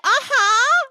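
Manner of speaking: very high, loud, frantic assent; distracted fake interest. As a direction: overdo the nod while panicking. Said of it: "totally mind racing".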